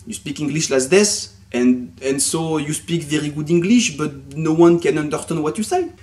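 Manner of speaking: slow English in a heavy French accent; thick French accent. Shown as a demonstration. Teacher mimicking French pupils nobody can understand, thick French accent. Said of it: "tu parles comme ça".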